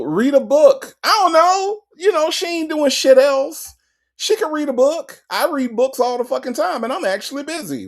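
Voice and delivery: exasperated outburst. Real, not acted. Host rants at Sonya to read a book, exasperated.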